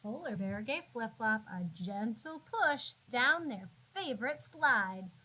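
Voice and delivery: ordinary delivery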